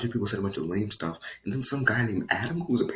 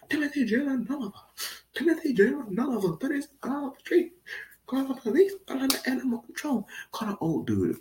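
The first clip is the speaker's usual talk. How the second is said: hysterical snitch; 13 semitones higher than usual. Pretend. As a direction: shriek for the police like an outraged neighbor. Hysterical mock neighbor.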